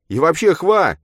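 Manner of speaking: high, loud cut-off; loud outburst. A narrator's reading. Man has had enough of the argument, shouting.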